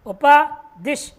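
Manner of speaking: उपदिश drawn out syllable by syllable; slow spelled-out word. Shown as a demonstration. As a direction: stretch उपदिश out very slowly for the class. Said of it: "teacher spells out the form". (उपदिश को बहुत धीरे खींचकर)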